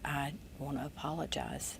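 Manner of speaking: whispered, soft confession; hushed apology. Retold or imitated. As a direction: whisper it like a man finally apologizing. Rick whispering his apology.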